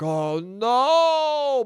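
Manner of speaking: mild hammed-up dismay; dad's dismay. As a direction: draw it out like a distraught dad. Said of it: "My dad immediately would be like".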